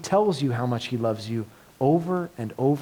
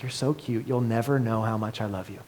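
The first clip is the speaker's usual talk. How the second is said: soft, lower, adoring murmur; fond inner parent voice; 5 semitones lower, 5 dB quieter than usual. Acted. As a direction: melt at the child's words. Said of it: "the parent just has this moment internally".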